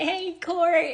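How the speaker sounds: high-pitched sing-out hello; retold sisterly greeting.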